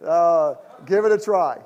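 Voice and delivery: loud, laughing "give it a try"; laughing tease. Real, not acted. Pastor teasing a couple in the front row, laughing loudly. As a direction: toss the phrase at them through a laugh.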